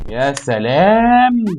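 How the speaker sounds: slow, high, drawn-out sarcasm; sarcastic wow. Real, not acted. Gamer mocking the game maker, slow high sarcasm. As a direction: drag it out mockingly.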